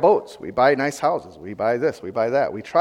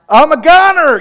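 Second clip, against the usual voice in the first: loud exclaim; 10 semitones higher, 11 dB louder than usual. Real, not acted. Congregant answers, preacher echoes loudly.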